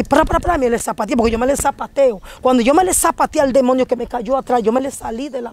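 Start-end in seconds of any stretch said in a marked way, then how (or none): 0.0-0.6 higher, rapid "pra" sound effect; imitated stomping noise. Telling how she stomped at the demon, imitated stomping. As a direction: rattle off mimicked kicks. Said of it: "y yo hice así".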